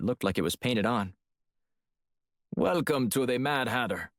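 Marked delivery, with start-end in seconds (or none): 2.6-4.1 put-on clipped Russian accent; clipped, heavy Russian vowels. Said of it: "in a clipped Russian accent".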